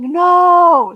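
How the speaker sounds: loud, high, drawn-out mock wail of protest; dismayed cry. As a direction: protest like a let-down fan. Acted dismayed reaction to the ending.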